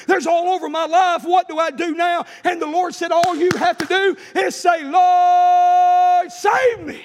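loud, driving revival sermon with long-held cries; full-force preaching. Acted. Preacher urging congregation to cry out to God, full-force preaching. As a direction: preach it hard and ring out the big words.

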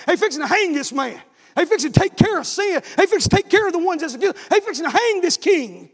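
high, emphatic pulpit delivery; fiery revival preacher, rising in intensity; full-force preaching. Acted. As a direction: hammer each repeated phrase at the congregation.

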